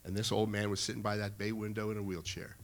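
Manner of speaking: ordinary delivery, nothing marked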